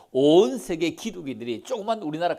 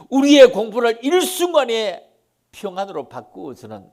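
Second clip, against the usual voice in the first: loud, higher proclaiming; preaching at full force; 7 semitones higher, 10 dB louder than usual. Real, not acted. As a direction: thunder it out from the pulpit. Preaching climax. (설교하듯 힘차게 외치며)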